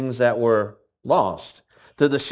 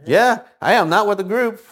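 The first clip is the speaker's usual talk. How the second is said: lightly voiced lost sheep, higher and louder; 9 semitones higher, 4 dB louder than usual. Preacher voicing the lost sheep, higher and louder. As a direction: play the clueless sheep, a bit higher.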